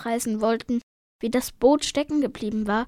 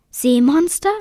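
loud, high-rising question of disbelief; aghast; 5 semitones higher, 10 dB louder than usual. A narrator's reading. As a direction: echo it in alarmed surprise. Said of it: "Louis' Gesichtszüge entgleisten". (entsetzt und ungläubig)

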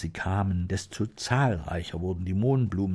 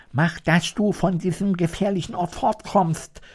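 high, louder urgent call; scarecrow urging; 9 semitones higher, 5 dB louder than usual. A narrator's reading. Scarecrow to lion, warning of danger: high urgent call. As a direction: push him to run, much higher. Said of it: "sagte der Scheuch zum Löwen".

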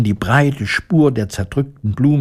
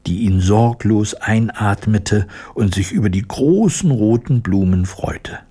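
plain reading voice

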